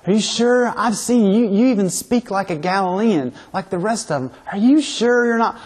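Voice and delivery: put-on accusing servant girl. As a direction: press him, disbelieving. Acted courtyard accusation.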